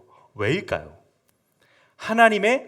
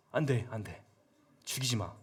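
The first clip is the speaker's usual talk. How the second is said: tag; 8 semitones lower, 15 dB quieter than usual. Acted. David restrains soldier afterward.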